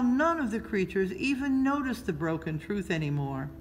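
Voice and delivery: ordinary delivery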